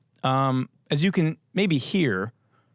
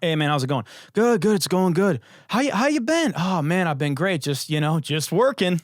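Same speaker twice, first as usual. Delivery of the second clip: higher, hammed-up chit-chat; mock small talk; 5 semitones higher than usual. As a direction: mimic empty wedding pleasantries. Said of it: "every wedding conversation ever".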